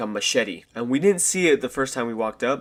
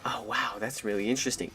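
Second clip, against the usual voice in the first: mock fake interest; 7 dB quieter than usual. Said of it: "we're just like".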